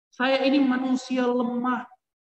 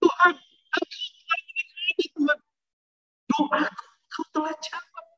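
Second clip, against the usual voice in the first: tearful prayer; 5 semitones higher, 3 dB quieter than usual. Retold or imitated. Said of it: "saya menangis".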